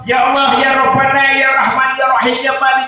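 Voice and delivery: high, ringing plea to God; fervent acted supplication, pitched high; prayer to God. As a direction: call out each divine name in an insistent retold prayer. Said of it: "saya hanya bilang sama Allah".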